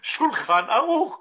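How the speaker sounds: normal talking voice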